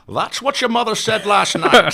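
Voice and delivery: loud, high, slow mock Connery; brash Connery jab, drawn out; Sean Connery impression. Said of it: "I did the Sean Connery".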